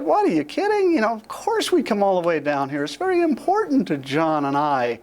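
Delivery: high, eager what are you kidding; brightly brushing off his apology; surprised protest. Retold or imitated. Daughter to her father in hospital, high eager protest.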